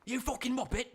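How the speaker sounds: high-pitched mock English insult; English accent. Imitating English people calling someone a Muppet, English accent. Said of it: "people from England call someone a fucking Muppet".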